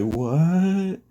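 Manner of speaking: high, drawn-out incredulous "what"; mock shock, pitched well up; stunned. Mineta reacts to Deku not being a virgin, stunned.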